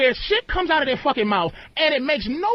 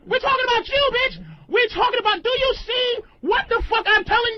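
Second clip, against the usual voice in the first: high-pitched, agitated rant; real heated outburst, voice climbing high; worked-up exasperation; 8 semitones higher than usual. Real, not acted. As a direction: lay into them, too wound up to breathe. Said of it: "Easy breath, Pat".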